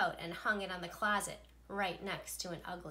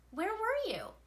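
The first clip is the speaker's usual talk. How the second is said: put-on eager kids; 12 semitones higher, 4 dB louder than usual. Excited kids question teacher.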